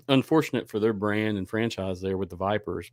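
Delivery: normal talking voice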